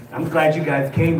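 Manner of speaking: ordinary talk, nothing marked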